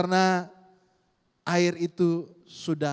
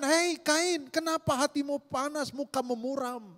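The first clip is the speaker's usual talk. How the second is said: God rebuking Cain; 9 semitones higher than usual. Acted. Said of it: "Bahkan Tuhan katakan".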